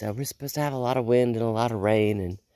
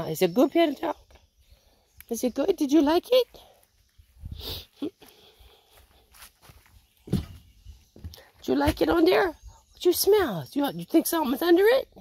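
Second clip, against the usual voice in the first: high doting voice for the family dog; talking to the dog. Real, not acted. Cooing over the dog.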